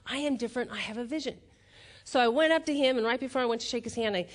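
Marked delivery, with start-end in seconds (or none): none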